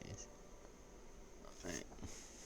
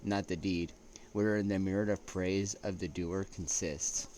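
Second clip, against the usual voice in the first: reading a quote aloud; 5 dB louder than usual. Acted. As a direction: read it out like a solemn citation.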